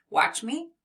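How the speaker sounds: ordinary talk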